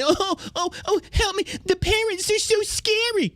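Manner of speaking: mock whining. Mocking school board whining to the Biden administration. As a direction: whimper it like a scared crybaby. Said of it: "go run whining to the Biden admin".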